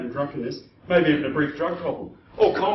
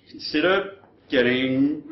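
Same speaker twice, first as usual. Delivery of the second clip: usual speaking voice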